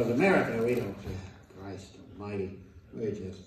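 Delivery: ordinary talk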